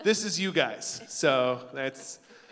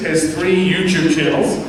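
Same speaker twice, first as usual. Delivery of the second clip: normal talking voice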